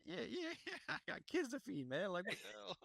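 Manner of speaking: high, laughing mock whine; laughing whiny voice. Mocking officer, laughing.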